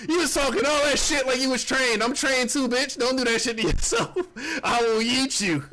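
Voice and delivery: daring her, half-laughing; mock tough callout. To the girl who tried to kick him, high mock taunt. Retold standoff with short girl.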